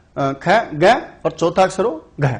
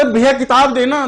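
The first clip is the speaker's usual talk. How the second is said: second louder call; 7 semitones higher, 3 dB louder than usual. Retold or imitated. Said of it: "उसने दो बार करके बोला".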